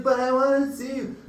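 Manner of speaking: high pleading mock drunk girl; drunk girlfriend voice. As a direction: imitate her begging to see him.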